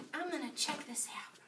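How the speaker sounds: soft, secretive whisper; hushed pretend explorer; sneaky whisper. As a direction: whisper while creeping up for a look. Sneaking up on toy dinosaurs.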